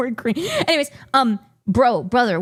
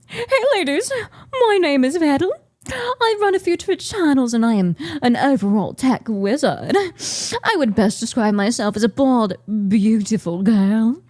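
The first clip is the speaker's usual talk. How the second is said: Vedal; 6 semitones lower than usual. Acted. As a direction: read it in a put-on British accent. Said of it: "I didn't read it like Vito".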